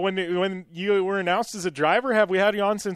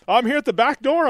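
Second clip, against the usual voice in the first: raised, higher pitched caller voice; Steve's matter-of-fact phone voice, pitched up; voicing Steve on phone; 9 semitones higher, 5 dB louder than usual. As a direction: announce it like he's right outside.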